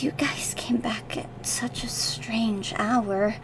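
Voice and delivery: hushed late-night whisper. Acted. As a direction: whisper it, slow and soft.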